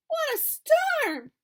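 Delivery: high, slow chirpy bird voice; twittering Wren. Acted. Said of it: "twitters Wren".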